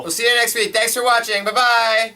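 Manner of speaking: chirpy sign-off. Acted. Upbeat show sign-off.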